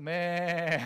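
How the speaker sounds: drawn-out imitation of a bleating sheep; sheep's bleat. Shown as a demonstration. Preacher showing the congregation how sheep cry, imitated bleat. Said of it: "양이 어떻게 우나요?".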